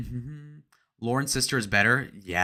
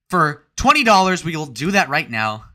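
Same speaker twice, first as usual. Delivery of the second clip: loud hype; 4 semitones higher, 8 dB louder than usual. Pretend. Hyped offer to chat.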